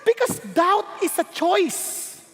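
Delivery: slow, deliberate phrasing; slow preacher emphasis; slow emphatic. Shown as a demonstration. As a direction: lay each word down slowly. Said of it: "Let me repeat".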